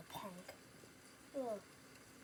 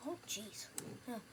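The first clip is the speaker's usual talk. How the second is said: ordinary delivery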